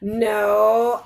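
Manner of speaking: usual speaking voice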